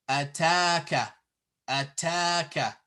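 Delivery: ataka with a long held ta; ataka, "taa" stretched; long vowel. Shown as a demonstration. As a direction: draw out the middle a of ataka. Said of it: "So we say ataka".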